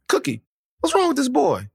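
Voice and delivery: high, insistent fatherly questioning; questioning dad. Retold or imitated. Dad asks mom about the boy, high and puzzled. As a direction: imitate a baffled dad demanding an answer.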